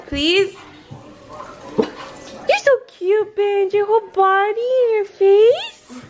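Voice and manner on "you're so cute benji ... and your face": high cooing at the puppy. Real, not acted. Cooing at the dog.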